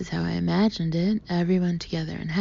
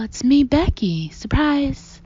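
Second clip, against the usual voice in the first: loud, slow yell; 7 semitones higher, 7 dB louder, slower than usual. A narrator's reading. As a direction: yell it out gleefully. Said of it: "I yell".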